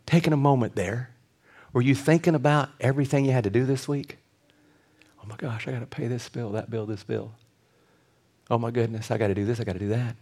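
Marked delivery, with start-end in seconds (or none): 5.2-10.2 soft, low run-on muttering; muttered worried thoughts. Acted. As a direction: fret under the breath to yourself. Acts out busy, worried thoughts.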